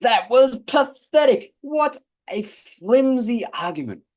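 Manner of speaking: high, loud, slow scornful jab; mocking debater. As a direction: sneer at the opposition. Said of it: "saying things like".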